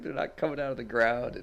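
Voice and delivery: imitating a friend's laughing contradiction; lightly acted scoffing at the panic; amused debunking. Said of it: "He just dies laughing".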